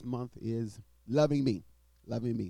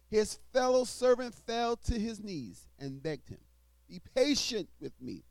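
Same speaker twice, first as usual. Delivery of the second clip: scripture reading; 10 semitones higher than usual. Acted. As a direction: proclaim the verses to the congregation. Reads scripture aloud in sermon.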